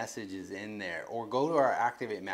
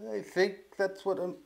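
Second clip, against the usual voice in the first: high, hesitant voice trailing upward; mock half-convinced waver, drawn out; not so confident; 6 semitones higher than usual. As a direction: act out someone unsure, slow and pitched up. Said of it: "not so confident or kind of semi like".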